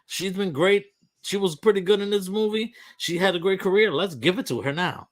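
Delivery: lightly voiced voters, high and fast; a hint of a lighthearted Academy voter; mock Academy voter. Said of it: "That was a vote".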